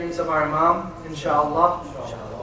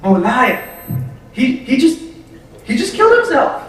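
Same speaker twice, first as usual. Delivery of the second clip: freaking out; 2 semitones higher than usual. Retold or imitated. First man sees his friend jump into the oven, panicked. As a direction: retell a man's horror as his friend vanishes into the fire. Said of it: "the first man starts freaking out".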